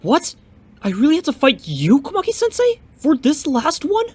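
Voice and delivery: can't believe you have to fight your own master; high, stunned protest, last words slowed; shocked disbelief. Acted. Pupil shocked at having to fight master.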